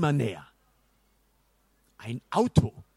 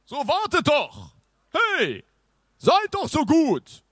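high, loud pleading shouts; calling after them; 13 semitones higher, 6 dB louder than usual. A narrator's reading. Knight begs the fleeing boys to wait, high loud calls. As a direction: call urgently to people running away. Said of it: "rief er den beiden hinterher".

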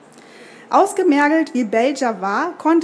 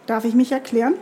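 usual speaking voice

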